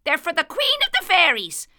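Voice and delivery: high, quick little leprechaun voice; chirpy fairy shoemaker, proud. A narrator's reading. Leprechaun answers Dan.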